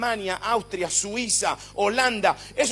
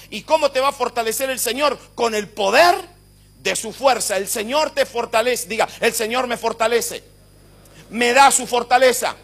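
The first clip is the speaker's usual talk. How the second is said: loud, raised, driving pulpit voice; full-force preaching; 3 semitones higher, 9 dB louder than usual. Acted. Preacher telling the church the Lord gives them capacity, full-force preaching. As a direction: build to a shouted climax, hammering each repeated phrase.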